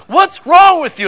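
high, loud outraged mom shout; mock mom yell. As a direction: bellow it like a furious mother. Mom yelling at her son.

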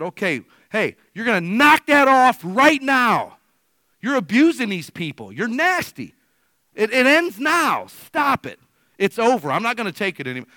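loud, high shout laying down the law; angry confrontation. Retold or imitated. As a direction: re-enact a furious telling-off, drawing out the commands. Acted confrontation with abusive pharmacist.

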